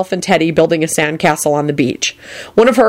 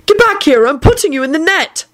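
exasperated scolding; 10 semitones higher than usual. A narrator's reading. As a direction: bark orders at the toad. Neville scolding escaped toad.

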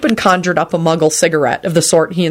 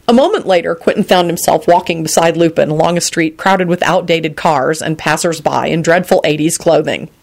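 usual speaking voice

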